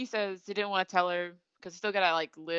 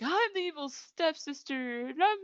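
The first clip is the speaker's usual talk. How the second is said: high-pitched recital yell without real volume; mock yell; 8 semitones higher than usual. Imitating Toru reciting stepsister lines, mock yelling. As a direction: declaim it high, half-hearted shouting. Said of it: "like, yell".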